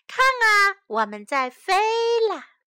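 high, loud joyful call; happy shout. Acted. Mother and child running downhill, loud happy shout. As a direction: shout it out gleefully. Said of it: "一边喊着".

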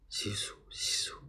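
very soft whispered rustling; whispered leaf rustle. A narrator's reading. Narrator imitates bamboo leaves along the path, whispered rustle.

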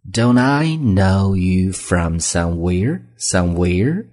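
somewhere rising clearly at the end, said slowly; rising question tone. Shown as a demonstration. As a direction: stretch the question and lift the last word. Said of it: "这是一个声调". (放慢读，somewhere 用升调)

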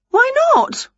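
very high, indignant question; protesting witch. A narrator's reading. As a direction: object high, as a rejected witch. Winnie rejected, protesting.

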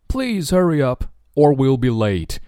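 impatient urging; urgent. Shown as a demonstration. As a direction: rush them out the door. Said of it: "赶紧走吧，不然我们就迟到了".

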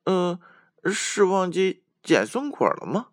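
high, teasing little squirrel; joking squirrel. A narrator's reading. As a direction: tease playfully in a high voice. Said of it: "小松鼠开玩笑地说".

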